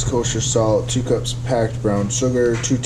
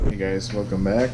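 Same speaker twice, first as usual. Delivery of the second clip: usual speaking voice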